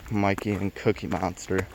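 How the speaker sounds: ordinary delivery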